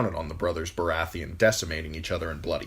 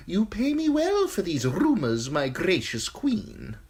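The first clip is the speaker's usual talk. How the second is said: high, sly eunuch voice, unhurried; high giggly spymaster relishing the gruesome news; Varys the eunuch; 13 semitones higher, 4 dB louder than usual. Acted. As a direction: purr it high and insinuating, a courtier savouring gossip. Varys voice held.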